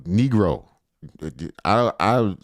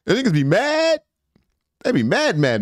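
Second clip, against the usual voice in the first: mocking mad viewers; 11 semitones higher than usual.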